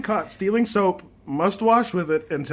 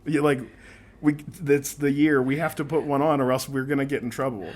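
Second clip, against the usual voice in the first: ordinary talk, nothing marked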